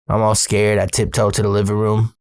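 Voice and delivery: ordinary delivery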